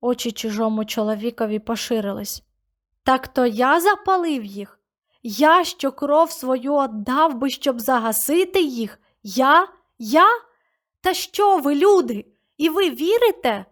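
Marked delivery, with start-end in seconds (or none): 3.0-13.6 high, loud, pleading cries; desperate denial. A narrator's reading. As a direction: beg them to believe you.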